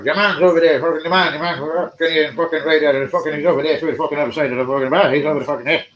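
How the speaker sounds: loud, pushy Irish pub barman voice; Irish barman accent. Retold or imitated. Irish barman in the pub pointing out Ian Wright, loud Irish accent. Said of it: "And the Irish barman".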